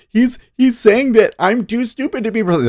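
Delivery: high, whiny mock of a rival; mocking Amy. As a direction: squeal it like a hurt politician. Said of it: "She's like".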